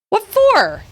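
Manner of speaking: high-pitched, affronted question; indignant protest. Acted. Blamed character answers back, indignant.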